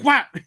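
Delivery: high loud impact sound; mock whap sound. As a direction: smack the word out like a hit. Rock hitting him.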